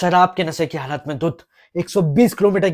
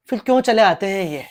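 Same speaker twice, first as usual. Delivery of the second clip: indignant outburst; 6 semitones higher, 6 dB louder than usual. Acted. Lawyer's indignant courtroom question.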